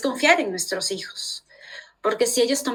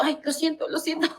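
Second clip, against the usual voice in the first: embarrassed laugh; 6 semitones higher, 5 dB quieter than usual. Retold or imitated. Embarrassed apology retold.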